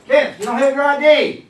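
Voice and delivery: high, loud hallway yell; shouting it out. Retold or imitated. Teacher yelling at a student in the hallway, loud shout. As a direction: call him out across the hall. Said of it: "I'm shouting it out".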